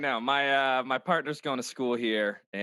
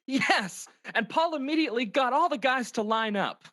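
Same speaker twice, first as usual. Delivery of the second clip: high, put-on storytelling voice; high-pitched comic friend; gossiping friend character; 9 semitones higher than usual. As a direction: retell the night out in a high character voice.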